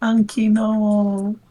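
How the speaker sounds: high mock child voice echoing the line; mimicking squeak. Player echoing the girl's cry, mock squeaky. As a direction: parrot the girl's squeak, teasing.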